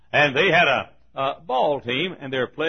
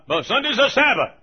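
higher, louder imitation of mother; raised-voice motherly objection; mother insisting; 11 semitones higher, 5 dB louder than usual. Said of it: "she said".

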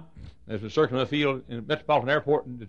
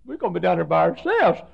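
mock fright; 10 semitones higher, 8 dB louder than usual. Preacher voicing John frightened of being left alone, mock fear. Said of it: "I think John said".

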